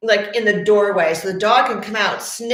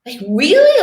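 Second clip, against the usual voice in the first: high, surprised "wow" and disbelieving "really"; amazed client; 10 semitones higher, 3 dB louder, slower than usual. Retold or imitated. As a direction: act out the client's astonishment, lifting up high. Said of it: "She was like".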